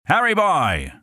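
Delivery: put-on parched troops bellowing for the water boy; soldiers shouting. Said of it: "we shouted".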